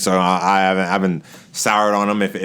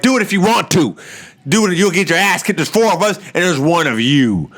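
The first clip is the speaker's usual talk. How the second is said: higher-pitched mock wrestler taunt; imitated trash talk, pitched up; taunting challenge; 7 semitones higher than usual. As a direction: dare him, playing the cocky rival. Acted taunting challenge from Jericho.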